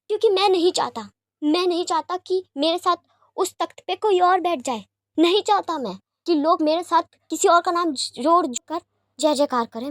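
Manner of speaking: heated outburst. Acted. Wing bursts out his refusal.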